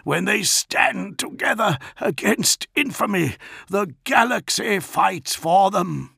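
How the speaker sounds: high-pitched, declamatory defiance; Dantiok. A narrator's reading. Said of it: "replied Dantiok".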